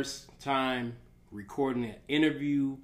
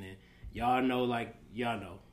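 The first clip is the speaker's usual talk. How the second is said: ordinary talk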